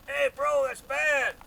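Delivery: high, very loud heckler's yell; mock a stranger's full-volume heckle; imitated yelling. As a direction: holler up at a painter on a ladder.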